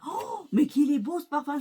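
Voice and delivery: a happy squeal of wonder at the scent; delighted quote. Retold or imitated. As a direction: gush over the perfume like a thrilled teen. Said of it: "elle fait".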